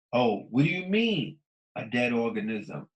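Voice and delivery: ordinary talk, nothing marked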